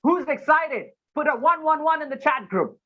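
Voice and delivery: high-pitched excited host call-out; rally the online crowd with an excited, lifted voice; hyping up an audience. Shown as a demonstration. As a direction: demo of an emcee firing up the chat. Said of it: "I give you a simple example".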